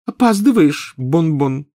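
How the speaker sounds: very high, loud pouting coo; high, pouting sweetheart voice; pouty girlfriend. Acted. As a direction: pout it at him. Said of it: "она надула губки".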